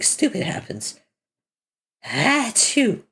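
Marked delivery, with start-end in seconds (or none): 2.0-3.0 high, sharp fake sneeze. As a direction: achoo from allergies, sneezing. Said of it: "My allergies".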